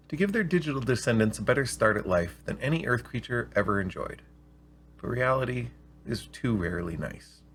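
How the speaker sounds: measured storyteller reading; unhurried read-aloud narration; calm audiobook storytelling; story narration. A narrator's reading.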